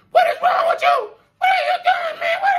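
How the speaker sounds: high-pitched laughing shout of disbelief. Retold or imitated. Laughing high at a friend pointing a gun at him.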